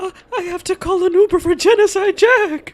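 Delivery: high, loud excited yell; excited shout. Real, not acted. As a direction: shout it out, thrilled. Joking shout about calling Uber.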